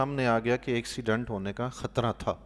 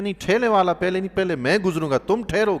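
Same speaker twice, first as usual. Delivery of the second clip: fast, loud, higher, pushy; impatient driver; 6 semitones higher, 5 dB louder than usual. Retold or imitated. An impatient driver tells the cart pusher to wait, fast and loud. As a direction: brush the cart man aside, no patience.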